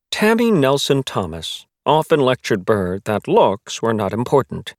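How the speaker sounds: plain reading voice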